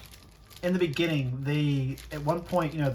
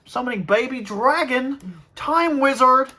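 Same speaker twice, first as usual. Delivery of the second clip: cartoon villain voice; 10 semitones higher, 8 dB louder than usual. Acting out the cartoon duel.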